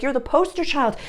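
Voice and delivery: high-pitched mock parental praise; imitated pushy parents. Parents telling their child he's special, in imitated praise.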